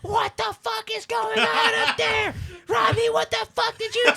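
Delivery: high, screeching full-volume yell; hammed-up shrieking mother, very loud; imitated furious mom. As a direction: scream up the stairs at your kid. Imitates angry mom yelling upstairs.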